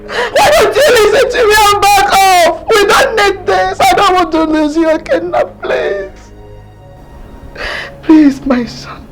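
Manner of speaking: crying, begging her son to back off; a mother's desperate tearful plea; tearful pleading. Acted. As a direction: plead through sobs, voice cracking.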